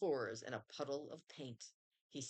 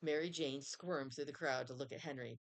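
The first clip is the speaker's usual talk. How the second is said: ordinary delivery, nothing marked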